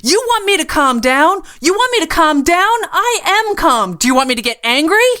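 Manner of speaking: loud, high, unhinged yelling; furious threats. A narrator's reading. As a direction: bark orders like a manic gunwoman. Gunwoman answers furious.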